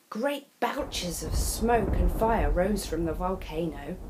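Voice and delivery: usual speaking voice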